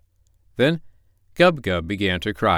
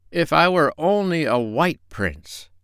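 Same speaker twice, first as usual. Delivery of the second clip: higher, slow wistful sigh; dreamy prince; 8 semitones higher, slower than usual. Acted. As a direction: dream aloud. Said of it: "with a dreamy, far-away look".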